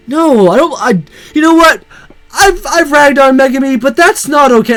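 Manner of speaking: worked-up indignant rant; agitated outburst. Real, not acted. Outburst over creepy teacher.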